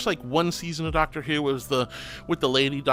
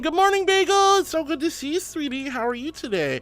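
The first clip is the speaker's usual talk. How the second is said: gushing high greeting; 13 semitones higher than usual. Real, not acted. Streamer gushing hello to chatter Bagel, high and excited. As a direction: greet her like a long-lost friend.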